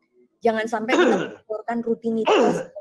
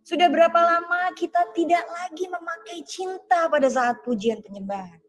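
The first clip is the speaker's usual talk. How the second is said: preaching; 4 semitones higher than usual. Acted. Pastor challenges online church about cold prayer, preaching. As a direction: press each "sudah berapa lama" harder.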